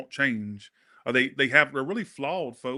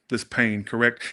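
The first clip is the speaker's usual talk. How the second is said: usual speaking voice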